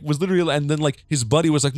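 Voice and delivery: normal talking voice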